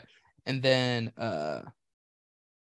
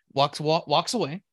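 usual speaking voice

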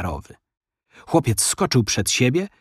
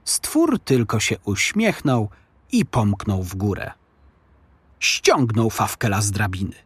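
ordinary delivery

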